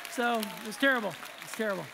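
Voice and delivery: normal talking voice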